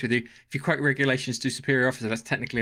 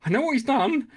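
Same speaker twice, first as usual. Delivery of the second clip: much higher, louder frantic voice; frantic Khan; 10 semitones higher, 4 dB louder than usual. Retold or imitated. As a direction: panic as you hunt for the button. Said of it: "And Khan's like".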